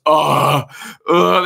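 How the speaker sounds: loud mock crowd gasp. Host imitating premiere crowd reacting to the roll, loud. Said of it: "the whole crowd goes".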